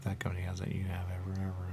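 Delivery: unvoiced whisper, secretive; whispered aside. Real, not acted. To the listener, a secretive whispered aside. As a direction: let them in on a secret.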